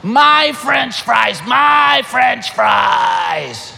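put-on slow, high, loud childish wail; grandkids wailing. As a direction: mimic kids clinging to their fries. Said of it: "their reaction is".

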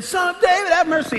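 high, loud yelled plea; retold yell. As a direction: cry out for mercy over the crowd. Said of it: "This guy, blind Bartimaeus, was saying".

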